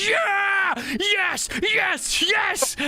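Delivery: high shouted yes, yes; cheering crowd. Acted. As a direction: cheer like a crowd gone wild. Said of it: "they're like".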